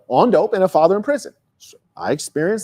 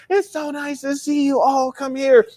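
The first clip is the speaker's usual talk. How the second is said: delighted Aunt Leslie; 10 semitones higher than usual. Retold or imitated. Speaker imitating his aunt thrilled to see him arrive. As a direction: welcome the boy like the best moment of your day. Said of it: "She been out".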